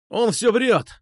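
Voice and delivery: high, hurried protest; blurting out. A narrator's reading. Marat interrupts accusation against him, blurting out. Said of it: "затарахтел Марат".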